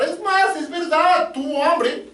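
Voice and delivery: mounting pulpit intensity; preaching rebuttal. Acted. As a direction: build the case point by point, voice lifted. Preacher builds up quoted rebuttal.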